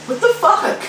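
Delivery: put-on high, shocked kids' voice; class reacting. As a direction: play the baffled whole class. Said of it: "is like".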